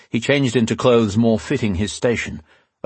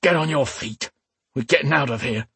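higher-pitched growl of command; 5 semitones higher than usual. A narrator's reading. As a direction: growl urgent orders at a groggy partner. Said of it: "the first man growled".

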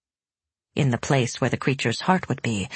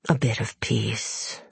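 whispering demon; 5 semitones lower, 5 dB quieter, slower than usual. A narrator's reading. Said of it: "whispered the demon".

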